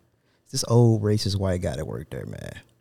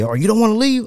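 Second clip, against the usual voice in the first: higher mocking taunt; taunting coworker; 11 semitones higher, 3 dB louder than usual. Retelling the argument, he mocks the coworker's sneering taunt.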